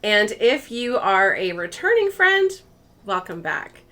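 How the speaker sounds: bubbly vlog intro. Acted. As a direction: welcome the viewers like old friends.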